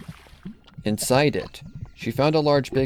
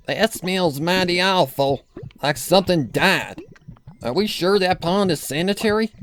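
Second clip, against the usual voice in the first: put-on creaky old farm granny, disgusted; Granny Smith voice; 8 semitones higher than usual. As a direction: grandmother wrinkling her nose at the stink. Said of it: "Granny Smith said".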